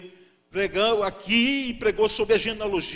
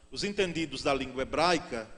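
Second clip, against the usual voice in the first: ordinary delivery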